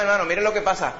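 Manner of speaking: ordinary delivery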